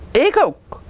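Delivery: put-on high, eager child's call. A narrator's reading. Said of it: "roept Mara". (hoog roepend, vol enthousiasme)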